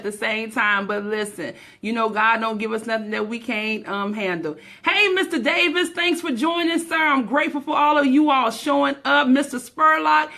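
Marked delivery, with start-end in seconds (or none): none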